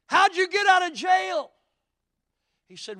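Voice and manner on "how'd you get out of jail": high, loud burst of a question; shouting the question at his freed friend; retold shout. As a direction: call it out high and loud in disbelief. Asking friend freed from jail.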